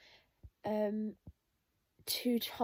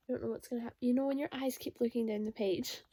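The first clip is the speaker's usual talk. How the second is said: usual speaking voice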